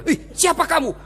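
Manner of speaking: high, startled challenge. Acted. Husband confronting a creature climbing from the well, startled. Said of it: "Kaget suaminya".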